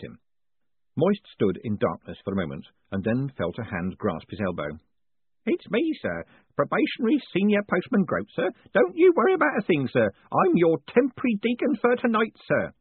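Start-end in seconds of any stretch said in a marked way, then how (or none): 5.4-12.7 put-on high, eager old postman; a fussy, kindly old postman, pitched up. Groat reassuring the hooded Moist.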